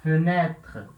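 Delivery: fenêtre said with an open ê, fe-nè-tre; fenêtre, stress the open ê in the middle; French fenêtre. Shown as a demonstration. Teacher models fenêtre for the circumflex. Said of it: "E com acento circunflexo".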